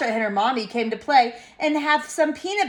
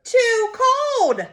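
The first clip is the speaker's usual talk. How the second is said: put-on very high childish yell; mock yelling; 12 semitones higher, 4 dB louder, much slower than usual. A narrator's reading. Reader showing how the child is yelling, high mock yell. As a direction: yell each complaint like a cross toddler. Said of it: "She's yelling".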